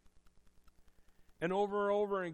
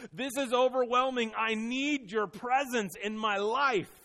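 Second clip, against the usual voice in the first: loud, higher plea, slowing at the end; crying out to God; 6 semitones higher, 8 dB louder than usual. Retold or imitated. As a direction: cry out the psalmist's plea. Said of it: "he also cries out to the creator".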